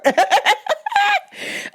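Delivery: high bursting laugh. Real, not acted. Host amused by her advice, high laugh. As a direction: laugh at yourself.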